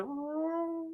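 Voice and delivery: soft, high drawn-out mock roar; half-hearted little dragon noise; imitated dragon roar. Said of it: "and the dragon's like".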